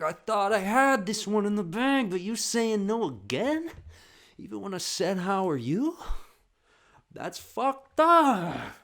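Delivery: put-on high, loud, drawn-out childish rant; hammed-up kiddie tantrum; outraged kid. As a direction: protest to mom like a cheated little boy. Imitating brother's outraged tantrum.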